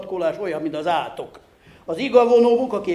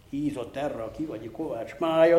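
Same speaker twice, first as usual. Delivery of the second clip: ordinary delivery, nothing marked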